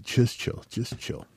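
soft, near-whispered aside; calm someone down under your breath; hushed placating. Retold or imitated. Trickster god quietly hushes the angry goddess, hushed and placating.

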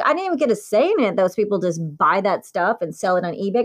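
usual speaking voice

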